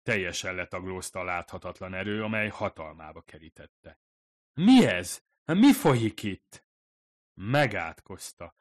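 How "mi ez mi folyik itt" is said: loud, high frightened cry, slowing at the end; panicked. Acted. Fumiko, crushed to the floor, cries out in panic. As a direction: she can't move and is terrified.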